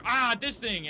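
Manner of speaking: loud, high, dismissive Rick voice; Rick impression. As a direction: toss it off like a careless genius. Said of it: "Rick would just be like".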